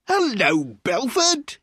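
high, hearty call of greeting; loud cry. A narrator's reading. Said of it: "he cried loudly".

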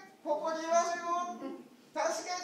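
high, pleading little voice; lamb calling for help. Acted. Lost lamb calling up for rescue, high and pleading. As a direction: cry for help from far below.

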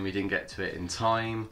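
normal talking voice

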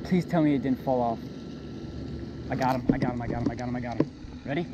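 ordinary delivery

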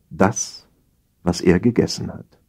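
ordinary talk

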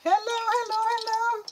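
high, put-on squeaky hello; a silly high voice calling out; playful high-pitched mock greeting; sing-song hello.

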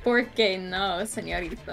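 mock Spanish quote. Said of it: "I thought you said".

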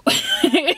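high-pitched laughing squeal; high burst of laughter. Real, not acted. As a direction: let a high giggle burst out. Laughs at own refusal.

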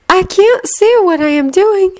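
high, slow airy voice; gentle, far-off instruction; dreamy distant voice. A narrator's reading. Luna's voiced dialogue.

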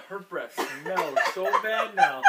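laughing. Real, not acted. Laughing about bad breath.

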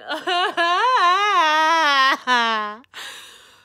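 loud, high laugh-cry; loud wailing laugh-sob. Real, not acted. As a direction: laugh breaking into sobs. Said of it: "Tears are coming".

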